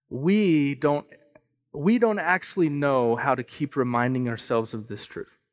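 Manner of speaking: ordinary talk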